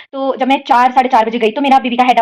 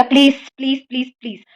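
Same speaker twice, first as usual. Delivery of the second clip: retold begging. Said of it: "फिर तो मैंने बोला".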